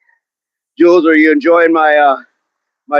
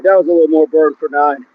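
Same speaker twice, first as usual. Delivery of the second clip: ordinary talk, nothing marked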